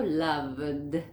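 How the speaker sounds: "loved" with an overdone final d; exaggerated D ending. Shown as a demonstration. Teacher exaggerating so learners notice, heavy final D. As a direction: stretch "loved" and sound the d. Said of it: "Estoy exagerando acá".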